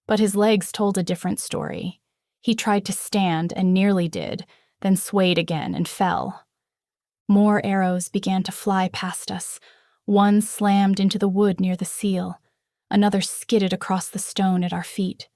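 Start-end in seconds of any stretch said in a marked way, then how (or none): none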